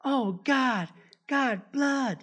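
high, loud mock yell; high-pitched imitation of a panicked shout; Norman Bates's horrified cry. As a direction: cry out in boyish horror, hammed up. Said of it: "el vato empieza a gritar como".

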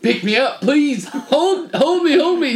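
high mock-panicked begging, rising to loud; pleading child. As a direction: beg dad frantically like a little kid. Child begs as dog threatens.

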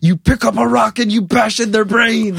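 high, exasperated mock-dad outburst; flipped-out dad. Dad ordering his son to fight back, flipping out. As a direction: blow up like a fed-up father. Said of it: "my dad flipped out and went".

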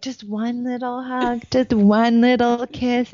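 put-on high, slow sing-song baby talk; sing-song mom. Mother cooing at her cranky daughter, high sing-song. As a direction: coo it sweetly, teasing a grumpy kid. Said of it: "And I'm like".

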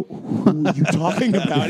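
slow confused question; mock confused. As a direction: ask slowly, baffled. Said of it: "I go".

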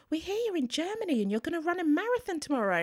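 high, fast retelling of the ambulance crew; quoted ambulance crew. Retold or imitated. Ambulance crew on the phone to a worried mum, high and fast. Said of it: "They said".

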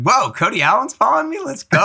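high, thrilled mock disbelief; excited. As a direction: pitch way up like a starstruck fan. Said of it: "And I was like".

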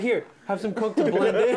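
mock offer. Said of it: "It's like".

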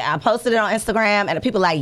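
normal talking voice

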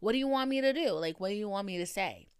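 usual speaking voice

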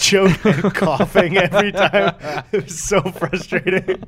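slow, breaking into laughter mid-sentence; real giggles spilling into the words; laughing. Real, not acted. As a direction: laugh your way through the story. Laughing while retelling game chat.

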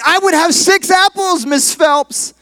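put-on high, drawn-out child's answer; eager schoolboy. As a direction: pipe up slowly like a proud pupil. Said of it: "And Johnny said".

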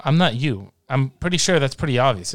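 ordinary talk

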